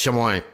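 "Chamoin" said as shuh-MOYN; mistaken pronunciation. Retold or imitated. Host blaming Wiccan friends for it, "Chamoin" as shuh-MOYN. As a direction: say "Chamoin" with MOYN stressed at the end. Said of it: "told me it was pronounced".

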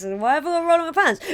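high, loud, rushed question; questioning house guest. Retold or imitated. Guest questioning the host's odd order, high rapid protest. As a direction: play the guest baffled, quick and high. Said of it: "So you're like".

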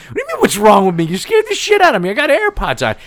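high-pitched, indignant mock shout; mad retort. Re-enacting own comeback to dad after the scare, mad outburst. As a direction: fire back in exasperated protest. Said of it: "and I got mad".

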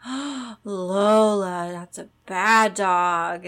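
mock scolding of dog. Telling the dog she misbehaved, drawn-out scolding. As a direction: stretch out each key word to shame a pet. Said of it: "both say it like that".

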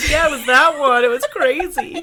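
high laughing reply. Real, not acted. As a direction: laugh through the words. Laughing at trailed-off remark.

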